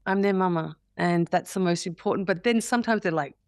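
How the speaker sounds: ordinary talk, nothing marked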